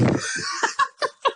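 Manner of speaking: high squealing laugh; real high giggle. Real, not acted. Laughs at own story.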